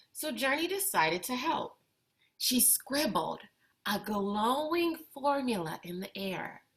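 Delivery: put-on expressive read-aloud for kids, lively and clear; picture book reading. A narrator's reading. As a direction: bring the story to life for young listeners. Reads picture book to children.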